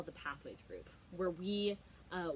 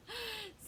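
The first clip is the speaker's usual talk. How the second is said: gasp; 4 dB quieter than usual. Acted. Reacting to child's answer.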